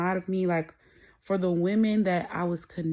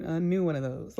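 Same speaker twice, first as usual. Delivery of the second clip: normal talking voice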